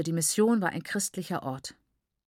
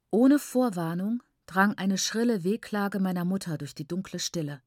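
ordinary delivery

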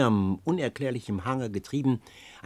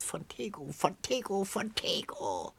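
babbling crone; 8 semitones higher, 7 dB quieter than usual. A narrator's reading. Old woman mockingly babbling the word back, high and whispered. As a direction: prattle the word like a mad old hag. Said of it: "kicherte und lachte ... und plapperte immerfort".

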